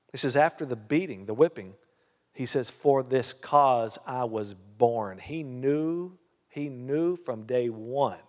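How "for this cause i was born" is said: "For this cause I was born" said slowly, "cause" leaned on; stress on cause. Shown as a demonstration. Preacher repeats the verse to the church, slow and emphatic. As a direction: drag out "cause" slow and weighty. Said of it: "Did you see that?".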